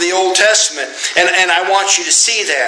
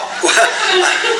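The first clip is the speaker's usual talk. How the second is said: high burst of laughter. Real, not acted. Laughs again.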